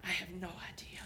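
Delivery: unvoiced whisper of doubt; anxious whisper. Moses whispering his doubts up the mountain. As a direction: confess it under your breath.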